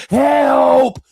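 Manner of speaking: high, drawn-out cry for help; mock scream. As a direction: imitate a drowning person screaming. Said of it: "whether you screaming 'cause you drowning".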